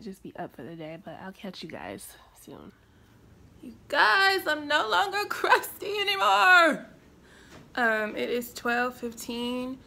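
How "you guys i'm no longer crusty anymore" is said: high, loud celebratory wail; jubilant shout. Real, not acted. Vlogger showing off presentable look to viewers, jubilant. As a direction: cry it out loudly, far up in pitch.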